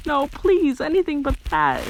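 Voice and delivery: high, mildly whimpering plea; sobbed plea. A narrator's reading. Captured fake Krampus begs the group, mock sobbing. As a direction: plead up high, a touch tearful. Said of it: "the not Krampus sobbed".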